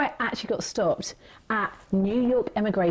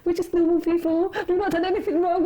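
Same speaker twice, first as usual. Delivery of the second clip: mock pleading; 8 semitones higher than usual. Retold pleading at border.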